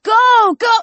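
high, loud urging; shouted. A narrator's reading. Nathan urges the riders past him, shouting. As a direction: yell to hurry them along. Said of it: "Nathan shouted".

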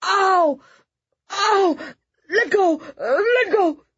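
high, loud cries of pain; pained scream. Acted. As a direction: yelp to be let go. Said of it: "Jack screamed".

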